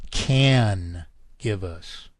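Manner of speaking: "can" hit hard and drawn out, slowed right down; stress on can. Shown as a demonstration. Lecturer rereading Churchill to his audience, slow heavy stress on "can". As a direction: lean heavily on "can", slow and deliberate. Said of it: "I emphasize the word can".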